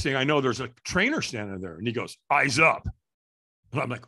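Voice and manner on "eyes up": loud, short retold order; trainer's command. Trainer to a firewalker staring at the coals, commanding. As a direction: call it out like a coach.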